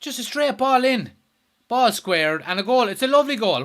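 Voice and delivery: loud, high-pitched goal commentary; worked-up football commentator; live play-by-play. Real, not acted.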